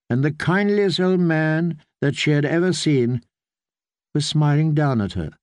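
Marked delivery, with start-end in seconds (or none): none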